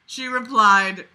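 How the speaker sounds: ordinary delivery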